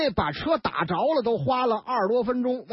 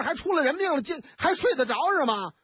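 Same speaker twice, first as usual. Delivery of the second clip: usual speaking voice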